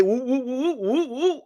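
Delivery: string of high-pitched "woo"s; high wooing noise. Acted. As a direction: cover the figure with hooting woos. Bleeps out the price paid.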